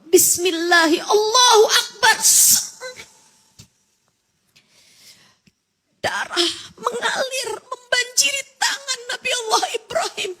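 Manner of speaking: weeping prayer. Acted. Ibrahim cries out to God after the sacrifice, sobbing. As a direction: sob it out as Ibrahim grieving.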